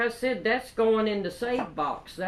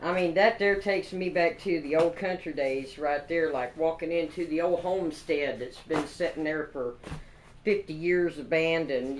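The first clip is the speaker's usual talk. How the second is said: usual speaking voice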